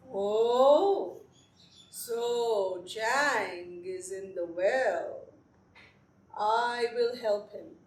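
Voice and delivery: put-on low, slow old man; a hint of a slow old man; lightly voiced old man. A narrator's reading.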